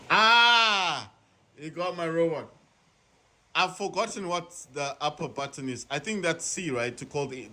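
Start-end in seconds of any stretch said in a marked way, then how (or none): 0.1-1.1 dismayed cry. Real, not acted. Robot destroyed.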